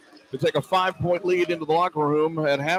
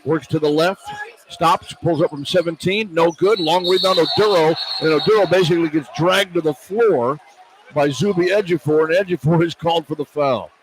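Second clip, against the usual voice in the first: brisk, louder radio game call; live play-by-play; 5 dB louder than usual. Real, not acted. Radio announcer calling the game for listeners, lively play-by-play. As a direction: call each move as it happens.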